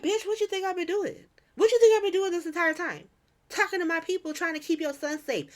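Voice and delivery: high-pitched, exasperated comeback; indignant retort. Retold or imitated. Lucas answering the warlord's accusation, high indignant protest. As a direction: imitate someone outraged at being blamed.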